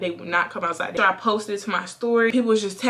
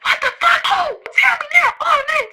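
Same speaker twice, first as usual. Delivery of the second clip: very high, loud wailing outburst; mock frantic mother; 6 dB louder than usual. Mom hearing her daughter got jumped, hammed-up frantic. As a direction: imitate a mom shrieking in panic. Acts out mom's frantic reaction.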